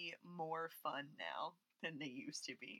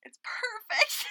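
gushing; 12 semitones higher, 7 dB louder, slower than usual. Real, not acted. Gushing about the film.

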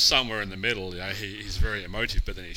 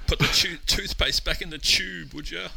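high, loud hammed-up whine; shrill mock plea to stop talking; mock nagging partner; 10 semitones higher, 10 dB louder than usual. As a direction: play the horrified partner, over the top. Mocking a partner who shuts down.

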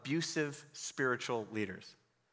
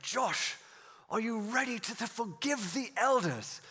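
higher, slow British pastor voice; imitated British accent, drawn out; 7 semitones higher than usual. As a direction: put on an earnest British pastor. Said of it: "who was this British guy".

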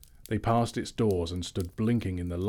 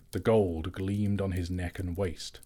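plain reading voice

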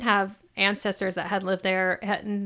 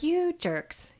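high, indignant you jerks; mock outrage at the guys; 9 semitones higher, 5 dB quieter than usual. Retold indignant outburst.

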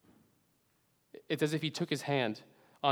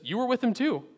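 higher, pointed accusation; accusing servant girl; 10 semitones higher, 4 dB louder than usual. Acted. Servant girl accusing Peter, higher and insistent. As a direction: point the finger at him, pitched up.

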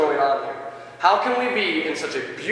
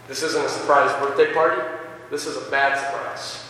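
normal talking voice

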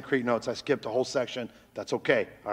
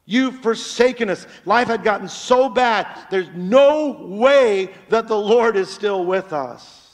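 louder, higher lament; voicing Gideon's lament; 6 semitones higher, 8 dB louder than usual. Preacher voicing Gideon's complaint that God left them. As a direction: cry out like a man abandoned. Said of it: "Gideon says that the Lord has forsaken us".

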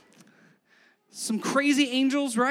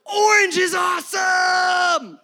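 slow, much higher, loud hammed-up cheer; mock fan shout; 9 semitones higher, 10 dB louder, much slower than usual. Pastor mocking fans cheering a celebrity, hammed-up shout. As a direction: gush like an overeager crowd of fans. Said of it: "and everybody goes".

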